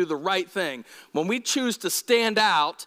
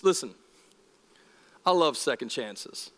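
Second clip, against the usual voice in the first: ordinary talk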